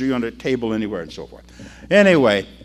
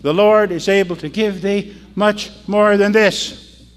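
loud, slow, high verse recital; leading a recitation; 6 semitones higher, 6 dB louder, slower than usual. Real, not acted. Preacher leading the congregation in the verse, emphatic recitation. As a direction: proclaim it so all can join. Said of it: "Say it with me".

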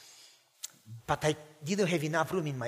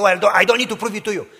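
much louder, fast, indignant; defensive outburst; 13 dB louder, faster than usual. Retold or imitated. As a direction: push back hard at dad. Son defensive against father.

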